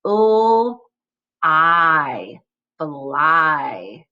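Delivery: "O... I... fly", separate sounds with pauses; sounded out. Shown as a demonstration. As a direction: segment "O", "I", then say "fly" whole. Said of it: "break it into its component parts".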